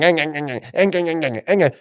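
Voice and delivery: mock whining eng sounds; mocking a negative person's whining, mild; whiny moaning noise. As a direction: whimper like a moaning grump. Imitating negative whining energy.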